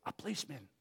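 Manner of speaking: very soft whispered answer; child's whisper. A narrator's reading. Boy telling the boss on the phone, whispering. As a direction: whisper it secretively. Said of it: "whispered the boy".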